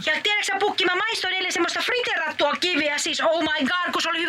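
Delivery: high, bubbly wife chattering from Tallinn; a giddy, high-pitched holiday wife; Joulumuori voice. Acted. Wife calling Pukki from Tallinn, high and cheery.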